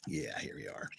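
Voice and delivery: soft unvoiced whisper. Real, not acted. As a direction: breathe it out, barely voiced. Whispered aside.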